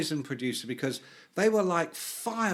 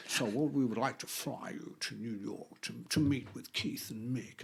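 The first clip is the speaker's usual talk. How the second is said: prank caller voice; 4 semitones lower, 9 dB quieter than usual. Retold or imitated. Prankster calling the writer, low put-on voice. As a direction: fake a smooth phone caller. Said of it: "it's this voice going".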